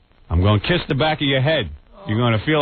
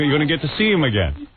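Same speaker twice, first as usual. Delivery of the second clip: usual speaking voice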